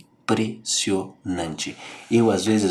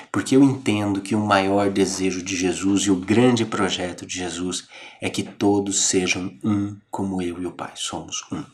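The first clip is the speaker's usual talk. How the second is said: usual speaking voice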